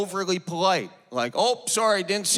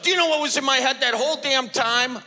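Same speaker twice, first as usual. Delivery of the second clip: mock angry golfer; 8 semitones higher than usual. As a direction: imitate the angry member, hammed up. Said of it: "he gets mad at me".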